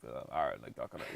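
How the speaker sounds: low, soft vocal fry; just woken up vocal fry. Retold or imitated. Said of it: "a vocal fry".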